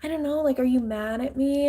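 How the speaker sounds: high, whiny fake innocence; mock innocent voice. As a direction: pitch it up, feigning innocence. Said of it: "who's like".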